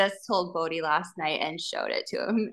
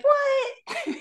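put-on shocked child. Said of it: "And he's like".

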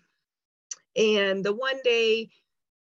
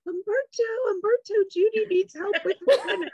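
much higher, calling across the room with a laugh; 12 semitones higher than usual. Retold or imitated. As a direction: call the waiter over, half laughing. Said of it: "She goes".

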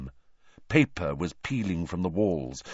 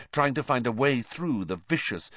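usual speaking voice

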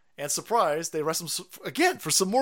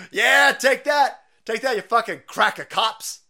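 high, loud, slow jeering crowd voice; mock cheering rioter; 8 semitones higher, 9 dB louder, slower than usual. Mocking the cheering crowd, a jeering rioter voice. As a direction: ham up a gloating rioter cheering on. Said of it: "they're all cheering".

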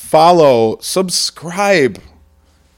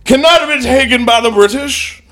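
put-on high-pitched preacher oratory in a muddled Scottish-Southern accent, slowing to the end; mock Scotch-Florida American; 6 semitones higher than usual. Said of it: "Scotch Florida American".

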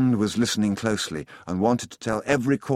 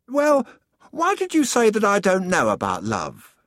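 the sun speaking, much higher and louder; lofty voice of the sun, pitched way up; warm beaming sun voice; 12 semitones higher, 5 dB louder than usual. Acted. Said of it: "the sun asked the boy".

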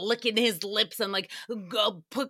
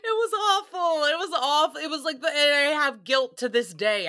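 higher, teary voice breaking; real crying; 4 semitones higher, 3 dB louder than usual. Real, not acted. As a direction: speak through real tears, higher. Grieving over dead cat.

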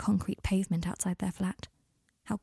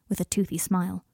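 plain reading voice

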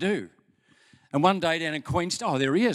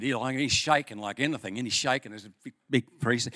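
normal talking voice

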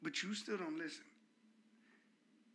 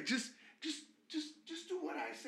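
higher, bossy mock parent; 10 semitones higher, 4 dB quieter than usual. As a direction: mimic a parent laying down the law. Daughter mocking parents' line.

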